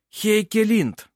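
slow, high, ringing summons; calling a witness. A narrator's reading. Lawyer calls his next witness, proclaiming. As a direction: call the name out across the hall.

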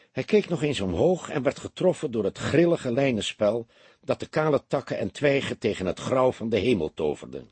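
ordinary delivery

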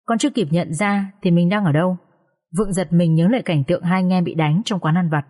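ordinary delivery, nothing marked

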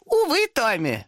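high, slow mock-regretful demon; demon reply. A narrator's reading. Demon refuses to leave Thomas alone. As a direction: sigh it out with fake pity. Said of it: "ответил демон".

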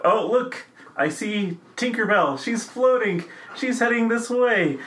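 high, breathless excitement at a fairy; excited wonder. Pretend. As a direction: point out Tinkerbell in delight. Announcing Tinkerbell arriving.

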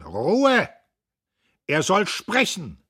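loud, high order for silence; shouted command. A narrator's reading. Old Buck orders the hall from the stage, commanding shout. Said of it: "von der Bühne herab Befehlen".

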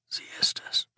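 a shaken, breathless whisper; hoarse whisper. A narrator's reading. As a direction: barely voice it, hoarse and nauseous. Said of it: "sagte er mit heiserer Stimme".

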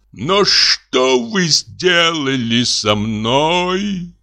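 high, very slow anguished moan. A narrator's reading. Deceived husband to his wife's lover, slow high moan. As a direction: groan it out as if wounded. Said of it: "простонал он".

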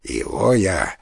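low, soft, deliberate voice; cannibal elder. A narrator's reading. The elder picks Кана to eat, low and menacing. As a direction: pronounce it low and quiet, slowing at the end.

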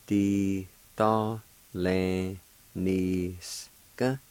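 "di to le ni ska" very slowly, each syllable held. Shown as a demonstration. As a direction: draw out each syllable of "di to le ni ska" with gaps between. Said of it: "I'll pronounce these words".

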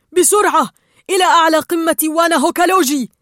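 loud, high rallying cry; urgent yell. A narrator's reading. Gill rallies the others as time runs out, urgent loud shout. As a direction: shout the order to hurry. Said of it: "فصرخ".

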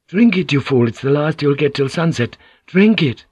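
high, loud exasperated command; angry order. A narrator's reading. Thirsty man pushing the cup back, angrily insistent. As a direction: order him forcefully to drink.

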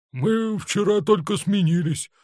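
high, slow, plaintive protest; making excuses. A narrator's reading. Senior guard answering the angry colonel, defensive excuse. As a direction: plead your excuse loudly to a superior. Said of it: "оправдывался старшой".

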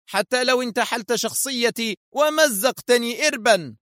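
high-pitched villain gloating over his captive. A narrator's reading. Foster boasts to the captive Adham, high gloating villain voice. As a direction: lay out the trap, smug and sure.

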